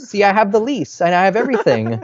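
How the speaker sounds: mock innocence to fool the owners; a lightly hammed-up innocent voice; playing innocent. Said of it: "She played innocent and was like".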